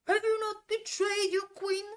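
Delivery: very high, loud yell through the whole question; over-the-top imitation of an actor yelling; mock screamed acting. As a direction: scream every word with equal force. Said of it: "If Tom did it, it would be like".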